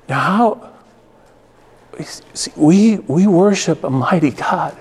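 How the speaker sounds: slow, forceful pulpit delivery; emphatic preaching. Real, not acted. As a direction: preach it out with weight on each phrase. Preaches the point of his story.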